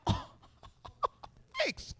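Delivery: high, soft sarcastic thanks; mock gratitude. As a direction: feign being grateful in a tiny high voice.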